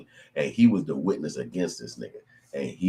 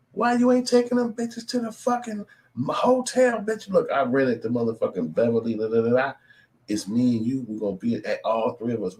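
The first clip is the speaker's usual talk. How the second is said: excited bragging voice; 6 semitones higher than usual. Pretend. High excited voice acting as him.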